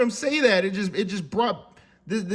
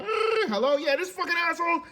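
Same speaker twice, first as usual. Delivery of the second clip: hotline caller; 8 semitones higher than usual. Retold or imitated. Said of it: "put it on the fucking hotline".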